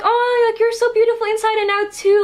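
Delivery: gushing [name]. Retold or imitated. [name] returning the compliment to the fan, high gushing imitation.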